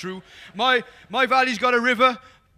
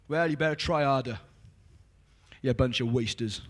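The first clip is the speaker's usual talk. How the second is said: a hint of a scolding God, much lower and softer; mock stern God; 9 semitones lower, 4 dB quieter than usual. Preacher mocking a harsh God who scolds the thirsty, low and grumbling. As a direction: growl it down like a disappointed judge. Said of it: "He doesn't say that 'cause God's not like that".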